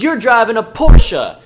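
slow, high, loud insistence; mock a pushy stranger; insistent shout. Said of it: "And she goes".

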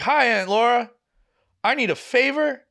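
high-pitched, mock near-tearful plea; imitating a desperate, almost-crying request; about to cry. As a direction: pitch it way up, as if tears are coming. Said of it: "I'm, like, about to start crying".

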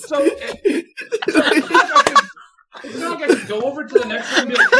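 high squeaky laughing protest. Real, not acted. Explaining to friends why no bath, high laughing squeal.

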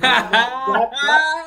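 high, loud sputtering; outraged. Real, not acted. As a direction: splutter in disbelief. Outraged at iPads.

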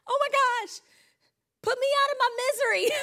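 mock-desperate, high and laughing; exasperated plea. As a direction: beg to be rescued, laughing. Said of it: "And I'm like".

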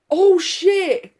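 high, loud burst of surprise; real eureka outburst; sudden realisation. Real, not acted. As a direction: blurt it out as it dawns on you. Sudden realisation interrupts the recap.

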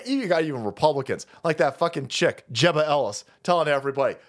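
usual speaking voice

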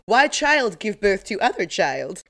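slow, deliberate meme-quote voice; mock bewilderment. Friend quoting a meme about friends' babies, mock bewilderment. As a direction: ask it slowly, in mock disbelief. Voicing the second meme line.

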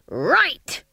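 high, loud rising shout; shouted approval. A narrator's reading. Stage manager approving his witches, shouted. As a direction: snap it out triumphantly.

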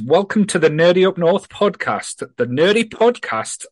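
posh, slow intro voice; hammy attempt at posh English. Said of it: "I did try and put on a posh voice".

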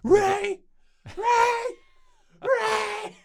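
very high, loud desperate yell; shouting Rey. Retold or imitated. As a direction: scream her name across the desert. Imitating Finn calling.

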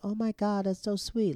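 put-on high, gushing girl voice; lightly put-on lovestruck coo; mock smitten. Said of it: "she's all smitten with Luke".

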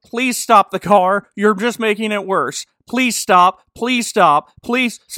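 loud, high, desperate begging; panicked pleading. Acted. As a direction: beg for your life, hammed up. Continued pleading.

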